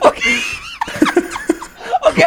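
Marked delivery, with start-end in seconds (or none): 0.5-1.6 shrieking laugh; high burst of laughter. Real, not acted. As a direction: squeal with laughter. Cracking up at Shrek joke.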